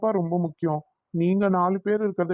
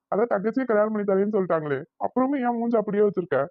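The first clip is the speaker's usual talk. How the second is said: ordinary talk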